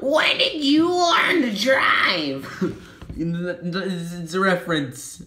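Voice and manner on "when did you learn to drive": 1 quoting a Vine. Retold or imitated. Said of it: "a Vine reference".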